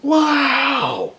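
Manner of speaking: long, loud, high-pitched yell; stunned exclamation. Retold or imitated. Husband reacting to wife's tirade, loud drawn-out astonishment. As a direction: stretch it out high in mock amazement.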